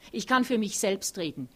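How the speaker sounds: laut und scharf zurechtweisend; anfahrend. A narrator's reading. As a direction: schnauzt ihn laut an. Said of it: "fährt Thea ihn an". (Thea fährt Otto laut und gereizt an)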